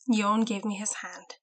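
ordinary delivery

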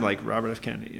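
ordinary talk

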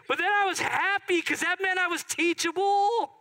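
very high, loud happy shout; stretched, jubilant pulpit cry; exultant preaching. Acted. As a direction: shout it joyfully to the congregation. Exclaims at full preaching force.

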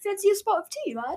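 high, mock British; British accent. Shown as a demonstration. Co-host showing off, high mock British accent. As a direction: put on a caricature British accent.

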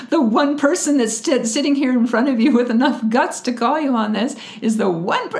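high, loud, indignant sarcasm; snarky twenty-year-old. Retold or imitated. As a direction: lay into them with pointed snark. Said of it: "in my snarky twenty-year-old voice".

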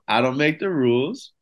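normal talking voice

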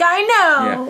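high, loud burst of agreement; excited agreement. Retold or imitated. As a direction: blurt it out emphatically. Retold excited agreement with film.

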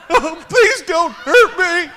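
very high, loud, slow whiny begging; whimpering mock plea. Pastor mocks the bully begging, very high and whiny. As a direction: ham up a terrified big man pleading. Mocking the big guy begging.

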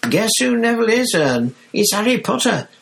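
very high, loud, fast babble; thrilled high-pitched chatter; gleeful excitement. Acted. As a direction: gush it all out to Ern. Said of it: "Stan shouted gleefully".

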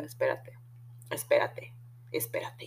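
low, hushed whisper held back; urgent whisper. Real, not acted. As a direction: keep it secret, barely breathing the words. Calmando a la amiga, conteniéndose. (susurro bajo y urgente)

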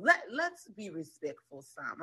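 soft, slow mock admonishment; imitated reproving man. Man objects to being cussed at, imitated soft reproach. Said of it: "the man can't stand for me to cuss".

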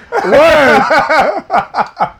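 sudden high burst of laughter; big laugh. Real, not acted. Laughing at rap albums joke.

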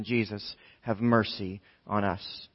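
slow, low, soft appeal; quiet, solemn retelling of a cry; hushed plea. Retold or imitated. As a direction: murmur the prayer with weight. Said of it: "crying out with abandon".

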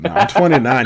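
mock indignant. Real, not acted. Protesting age teasingly.

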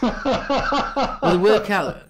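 laughing through the words; chuckling as you speak. Real, not acted. Laughing banter between hosts.

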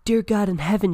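high, loud exclamation; alarmed shout. Acted. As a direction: shout it up in disbelief. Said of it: "shouted the teammate".

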